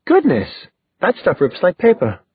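high-pitched, playful astonishment; exclaiming. A narrator's reading. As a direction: exclaim it in delighted mock surprise. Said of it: "she exclaimed".

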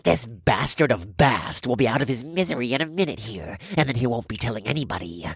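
nasal voice. Acted. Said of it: "the nasally voice".

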